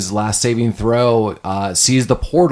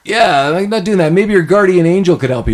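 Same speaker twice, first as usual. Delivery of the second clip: higher, brushing him off; mock dismissive; 6 semitones higher than usual. Frank brushing off the sheriff's book offer. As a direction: wave the sheriff off lightly. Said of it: "And he's like".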